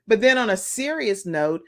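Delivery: ordinary talk, nothing marked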